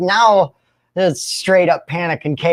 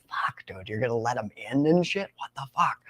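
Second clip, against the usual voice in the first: much softer, panicked hush; hiding in the bedroom; 3 semitones lower, 10 dB quieter than usual. Retold or imitated. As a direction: hush it like you're hiding under blankets. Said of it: "I'm in the bedroom like".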